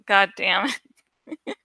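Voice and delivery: ordinary talk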